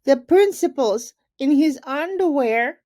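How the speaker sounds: put-on high, loud little-kid call; child calling out. A narrator's reading. Kindergarten child shouting at the assembly, high loud call. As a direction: blurt it out across the hall like a small child. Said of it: "a kindergarten child called out".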